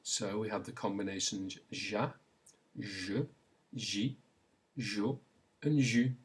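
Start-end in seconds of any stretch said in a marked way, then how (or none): 1.7-6.1 ja, je, ji, jo, ju with a soft zh sound; soft French j. Shown as a demonstration. Teacher shows the j with five vowels, soft French j. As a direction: sound out ja, je, ji, jo, ju separately, j as zh. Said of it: "it's really much softer than that".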